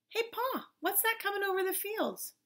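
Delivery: put-on high, soft child's voice; small boy asking, high and light; young boy. Boy asking his father about the giant, high child's voice.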